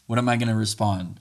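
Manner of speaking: ordinary delivery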